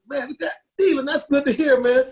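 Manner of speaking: fighting back happy tears; tearful joy, pitch cracking up high; choked up. Real, not acted. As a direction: voice breaking high with emotion. Overjoyed hearing son found mother.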